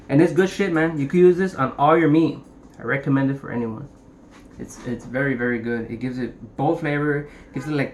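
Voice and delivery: normal talking voice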